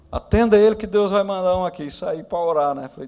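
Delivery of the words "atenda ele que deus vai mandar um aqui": high, loud commanding insistence; very serious. Retold or imitated. Pastor insisting the doctors treat his son, loud and firm. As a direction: order them with total faith. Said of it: "falei bem sério para os médicos".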